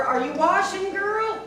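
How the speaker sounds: high call through a door. Acted. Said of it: "Bony Legs called through the door".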